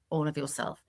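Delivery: ordinary delivery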